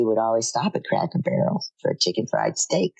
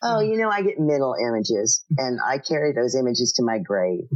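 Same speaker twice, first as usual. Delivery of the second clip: usual speaking voice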